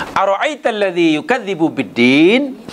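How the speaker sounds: "Ara'aital ladzi yukadzibu biddin" asked as a question, rising on "biddin"; question intonation. Shown as a demonstration. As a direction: recite it as asking, lifting "biddin" high. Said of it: "membacanya mesti dengan nada bertanya".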